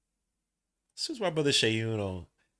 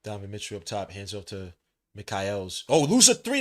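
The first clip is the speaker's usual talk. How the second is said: play-by-play; 3 semitones lower, 4 dB louder than usual. Acted. Streamer calling the Cavaliers game for chat, play-by-play. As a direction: call each move as it happens.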